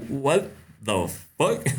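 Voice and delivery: slow, baffled retold reaction; incredulous, spaced-out delivery; retold bewilderment. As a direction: draw each word out in disbelief. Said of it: "I'm like".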